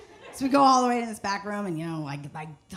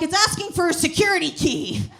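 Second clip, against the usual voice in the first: loud, higher excited shout; retold shout; 6 semitones higher, 5 dB louder than usual. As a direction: yell it out like a frantic tech guy. The Bing guy talking, shouted.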